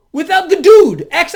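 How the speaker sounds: loud, high burst of outrage; outraged shout. Real, not acted. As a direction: blast it out in disbelief. Angry rant about absent leader.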